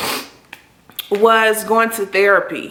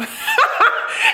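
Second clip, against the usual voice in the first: burst of laughter. Real, not acted. Laughs at therapist's reply.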